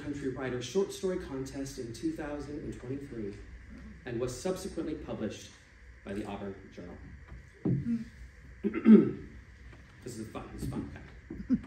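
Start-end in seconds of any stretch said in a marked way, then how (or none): none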